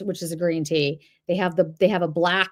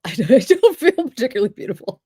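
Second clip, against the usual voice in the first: modest laugh breaking through the words; 5 semitones higher, 6 dB louder than usual. Real, not acted. Streamer answering a viewer's compliment, laughing. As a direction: laugh it off, pitch jumping up and louder.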